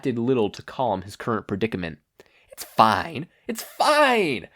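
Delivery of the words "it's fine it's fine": shaky, rising into a high squeak on the second fine; talking himself down from panic; villain insisting he is fine, voice cracking; nervous self-reassurance. Acted.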